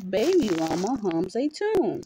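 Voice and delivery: ordinary delivery, nothing marked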